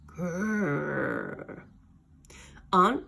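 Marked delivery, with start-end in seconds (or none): 0.1-1.6 low weak growl; pitiful growl. Acted. Slime trying to scare cats, weak growl. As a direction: growl feebly.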